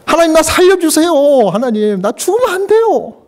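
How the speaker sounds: tearful plea. Acted. Hezekiah, deathly ill, begging God with mock tears. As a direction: beg God not to let him die, near tears. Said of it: "그러면서 막 울었어".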